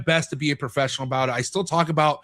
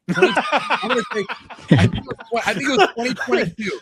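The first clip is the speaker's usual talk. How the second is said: laughing; 8 semitones higher, 4 dB louder than usual. Real, not acted. Laughing while recalling the year.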